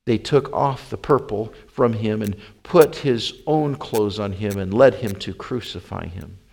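plain reading voice

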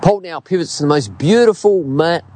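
ordinary delivery

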